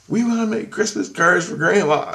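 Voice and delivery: put-on high, eager children asking; kids' voices. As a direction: kids asking a grown-up. Kids ask for card making.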